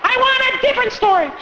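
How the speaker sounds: put-on a child shouting for a different story; whiny child. Child demanding new story.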